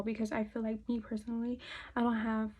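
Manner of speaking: normal talking voice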